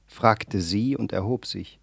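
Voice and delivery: plain reading voice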